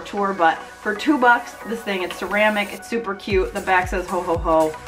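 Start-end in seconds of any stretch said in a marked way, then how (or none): none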